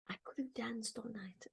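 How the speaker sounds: whispered song title, almost a secret; tossed-off whispered aside. Shown as a demonstration. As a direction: breathe the words without voice. Quoting the My Fair Lady song.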